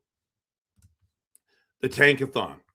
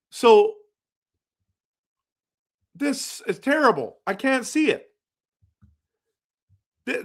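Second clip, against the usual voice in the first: frustrated; 10 semitones higher than usual. Real, not acted. Lottery sim won't show the pick.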